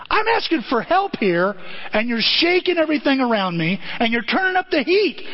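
high-pitched acted complaint to God; exasperated protest. Acts David protesting to God.

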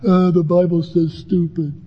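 lightly voiced kids, teasing and giggly; mock the verse like cheeky boys; a hint of boys teasing their father; sons teasing dad. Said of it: "and they'd always laugh".

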